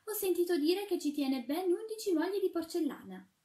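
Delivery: put-on high, girlish porcelain shepherdess. A narrator's reading. Shepherdess telling the chimney sweep her fear, high and girlish. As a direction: voice a frightened little shepherdess, pitched high.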